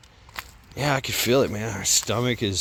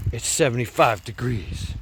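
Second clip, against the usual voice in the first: ordinary talk, nothing marked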